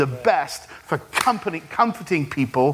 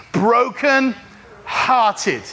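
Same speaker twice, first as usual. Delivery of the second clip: very slow, stretched out, higher pitched; drawn-out call-and-response; 4 semitones higher, much slower than usual. Shown as a demonstration. Preacher leading congregation to say it together, slow and emphatic. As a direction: stretch out each half for the crowd.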